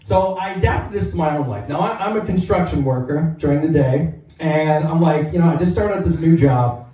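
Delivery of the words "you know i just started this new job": ordinary talk, nothing marked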